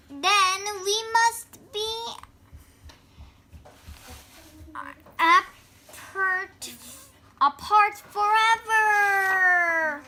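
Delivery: hammed-up tragic parting; dramatic farewell. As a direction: stretch "forever" like a sad goodbye.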